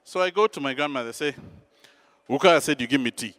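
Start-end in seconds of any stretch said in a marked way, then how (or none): 2.3-3.3 curt demand. Retold or imitated. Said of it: "No highs, no nothing".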